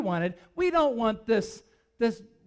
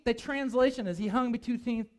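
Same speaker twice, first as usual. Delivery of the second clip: usual speaking voice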